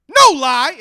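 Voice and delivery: put-on high, loud kid's yell; loud shout. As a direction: shout it to swear it's true. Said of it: "at that volume".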